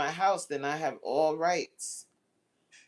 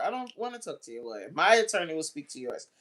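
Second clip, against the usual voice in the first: ordinary talk, nothing marked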